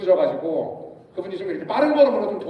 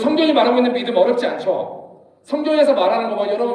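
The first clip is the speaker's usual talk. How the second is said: usual speaking voice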